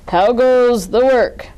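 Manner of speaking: slow, loud booming farmer; lazy farmer calling from bed; farmer's voice. A narrator's reading. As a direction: boom it out like a lazy bossy farmer. Farmer voiced in read story.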